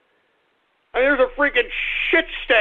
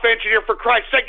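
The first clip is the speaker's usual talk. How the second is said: usual speaking voice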